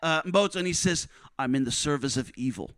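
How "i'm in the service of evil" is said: dark voice. Retold or imitated. Army officer staring down Mboto, in a much lower dark voice. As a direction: intone it like something evil speaking through him. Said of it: "with this really dark s-, voice".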